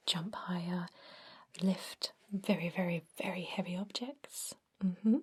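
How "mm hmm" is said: ordinary talk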